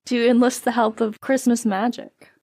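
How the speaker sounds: normal talking voice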